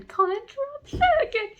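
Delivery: high-pitched excited retelling; giddy gushing. Real, not acted. Reader retelling the hero interrupting, giddy and high. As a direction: squeal through the retelling.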